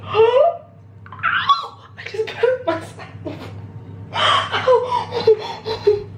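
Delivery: sharp high yelps of pain, laughing; burnt fingertips. Real, not acted. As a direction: yelp and laugh at the burn. Burns finger on hot oats.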